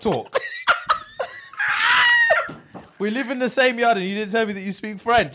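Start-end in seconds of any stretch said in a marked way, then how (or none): 0.4-2.5 howling, high burst of laughter; a big shouted laugh, genuinely cracking up. Real, not acted. Big laugh at the friend's betrayal.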